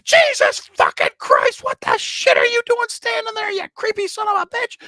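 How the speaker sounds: hammed-up furious shriek; startled angry scream. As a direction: screech like a waitress scared out of her wits. Acts startled waitress screaming at him.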